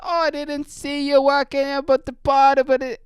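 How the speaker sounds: high-pitched mock Jamaican; imitated Jamaican accent. Jamaican dude explains himself to the mechanic, high Jamaican accent. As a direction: call it out high with a Jamaican lilt. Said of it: "I don't even know what the accent he has".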